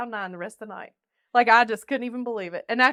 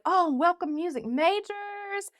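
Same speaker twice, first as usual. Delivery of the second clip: high, slow, sugary welcome; mock chirpy welcome; 10 semitones higher, 4 dB louder, slower than usual. Staff welcoming the new music majors, slow and high. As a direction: play the overexcited orientation host. Mocking orientation staff greeting.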